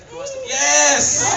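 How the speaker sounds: slow, high, loud shout of recognition; excited shout. Real, not acted. Spotting the girl in the crowd, a delighted shout. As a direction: call out to her with delight. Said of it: "spots the girl in crowd".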